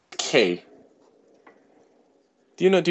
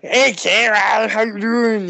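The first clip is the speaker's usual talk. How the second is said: put-on K's accent; 9 semitones higher, slower than usual. Greeting Kara the way coworker K does, high drawn-out accent. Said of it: "he's got a bit of an accent".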